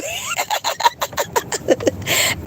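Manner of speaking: airy high giggle; high breathy laugh. Real, not acted. Laughing at the request.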